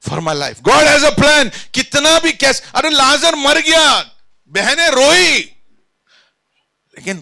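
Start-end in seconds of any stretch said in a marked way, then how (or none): 0.7-5.4 high, loud pulpit proclamation; raised, forceful preacher's voice; full-force preaching. Acted. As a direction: cry it out to the whole congregation.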